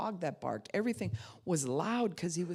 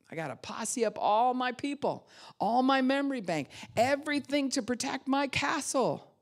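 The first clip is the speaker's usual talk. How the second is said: high, loud call to arms; rally the troops, voice raised high; mock battle rally; 8 semitones higher, 5 dB louder than usual. Speaker playing a castle defender to the class, rallying.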